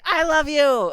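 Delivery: high, loud, hammed-up farewell; dramatic declaration. Teammate saying goodbye before diving, dramatic declaration. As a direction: declare it like last words, at full voice.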